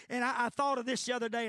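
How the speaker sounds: words tumbling out quickly; hurried preacher's aside; talking fast. Real, not acted. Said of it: "I know I'm talking fast".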